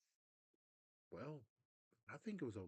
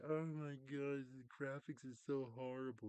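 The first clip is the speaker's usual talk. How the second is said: mock crybaby whine; 5 semitones higher, 5 dB louder than usual. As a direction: drag it out like a crying fan. Mocking crying fans.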